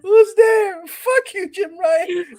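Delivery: high, loud laughing yell; cackling taunt; laughing shout. Acted. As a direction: shout the punchline while cracking up. Joke punchline while laughing.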